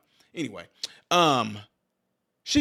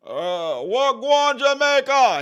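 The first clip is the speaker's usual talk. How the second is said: much higher, slow, louder Jamaican greeting; mock Jamaican greeting; 8 semitones higher, 5 dB louder, much slower than usual. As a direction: stretch it out like a tourist trying patois. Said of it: "like when Obama was like".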